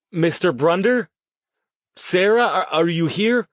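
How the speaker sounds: high, loud searching call; calling out loudly. A narrator's reading. Riley summoning the unseen Brunders, calling out loudly. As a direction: raise your voice and call through the room. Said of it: "Riley called out more loudly".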